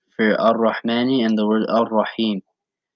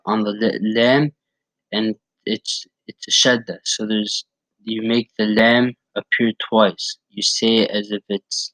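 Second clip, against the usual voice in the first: ordinary talk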